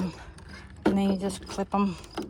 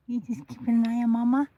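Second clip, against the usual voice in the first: normal talking voice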